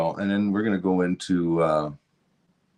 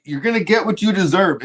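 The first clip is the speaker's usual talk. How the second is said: higher and louder stern warning; angry warning; 10 semitones higher, 6 dB louder than usual. Real, not acted. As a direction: hammer the warning home.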